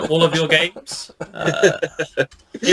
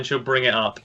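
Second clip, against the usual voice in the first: normal talking voice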